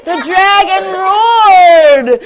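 loud, slow roaring telling; booming narration. A narrator's reading. Storyteller roaring for the dragon, booming. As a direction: roar the words out.

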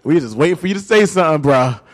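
ribbing a sibling who finally caught up; sister teasing. Retold or imitated. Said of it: "my sister's like".

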